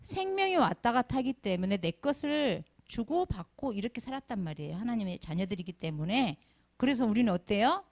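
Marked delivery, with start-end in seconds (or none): none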